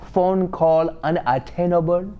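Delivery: lightly voiced Nigerian operator recording; a hint of a Nigerian accent on a phone message. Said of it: "over there, they be like".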